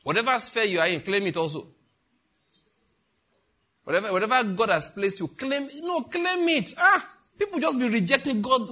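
rising preaching. Real, not acted. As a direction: drive it home with rising fire.

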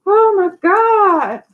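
high, loud, drawn-out amazement; astonished. Real, not acted. Host reacting to a viewer's message, astonished. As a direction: exclaim it high and slow, stunned.

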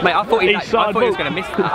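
loud, breakneck post-goal disbelief; excited shouting. Real, not acted. Commentator telling mates he expected a miss, excited shouting. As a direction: shout over the celebrations.

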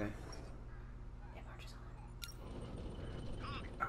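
ominous whisper. Acted. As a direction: breathe it out like a threat. Dramatic line about war machine.

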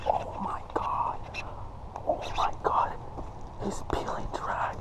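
hushed, thrilled whispering; excited whisper. Real, not acted. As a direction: whisper while the drag peels. Whispering excitedly during fight.

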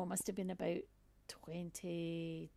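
normal talking voice

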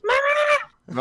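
put-on high squeaky childlike cry; squeaky mama. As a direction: call mama like a small child. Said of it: "超小声的啦".

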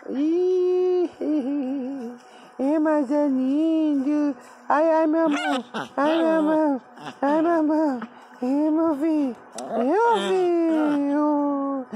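dote on the puppy lovingly; baby talk. Acted. Cooing at the puppy.

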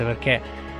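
normal talking voice

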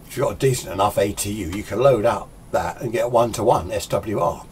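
ordinary talk, nothing marked

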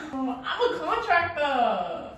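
laughing. Real, not acted. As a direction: laugh the words out slowly. Laughing, calling herself contractor.

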